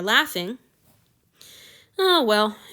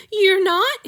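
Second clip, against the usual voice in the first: high, loud, breathless disbelief; gasped; 11 semitones higher, 7 dB louder than usual. A narrator's reading. Tearful boy hears he will be kept, gasping high. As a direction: gasp it in sudden hope. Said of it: "he gasped".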